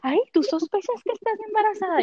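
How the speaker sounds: high squeaky surprised coworker voice; voicing the coworker, high and surprised. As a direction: pitch it way up like a startled nurse. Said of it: "Y ella".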